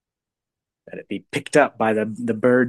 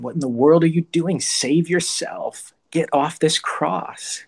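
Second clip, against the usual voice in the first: higher, mocking taunt, slowing on the last words; mocking jeer, higher and drawn out; jeering crowd; 6 semitones higher than usual. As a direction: taunt him like a scornful crowd at the cross. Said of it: "mock and jeer at him".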